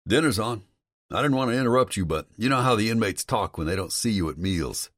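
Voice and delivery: a friendly guard telling him it's dinner; warden's voice. Acted.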